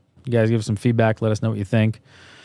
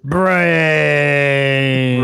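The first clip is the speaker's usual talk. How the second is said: mock zombie; 4 semitones higher, 4 dB louder than usual. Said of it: "with this mask on".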